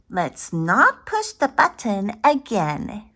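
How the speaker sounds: plain reading voice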